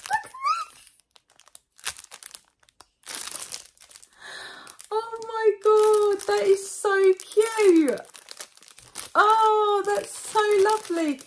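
high, loud, slow cooing; gushing over cute things. Real, not acted. Unboxing for her viewers, she coos, high and loud, over the cute gifts.

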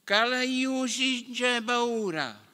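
high, slow, booming challenge; giant's shout. A narrator's reading. Giant warrior shouting his challenge at Guglielmo, slow and high. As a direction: bellow it at the knight slowly. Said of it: "gli gridò così".

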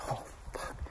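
whispered under the breath; scared whisper. Retold or imitated. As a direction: breathe it out in fright. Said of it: "I'm like".

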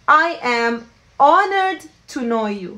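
slow, emphatic English phrase; slow teaching model. Shown as a demonstration. Teacher modelling the new phrase slowly for students. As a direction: stretch it out so learners catch every word. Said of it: "So بنحكي".

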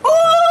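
sudden high loud oh; startled outcry; shocked shout. Acted. Manager walks in on the commotion with a shocked shout.

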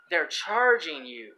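"charging" drawn out slow and loud; stress on charging. Real, not acted. Explaining a money term to listeners, drawn-out stress on "charging". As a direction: stretch "charging" heavily to drive the point home. Drives home wordplay on charging.

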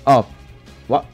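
ordinary delivery

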